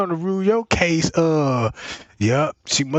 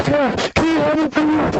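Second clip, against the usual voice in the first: higher, pleading cry for help; desperate caller; 8 semitones higher than usual. Retold or imitated. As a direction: beg the dispatcher in a panic. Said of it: "This how the nine one one call going".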